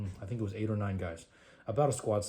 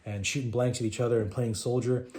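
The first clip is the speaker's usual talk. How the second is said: normal talking voice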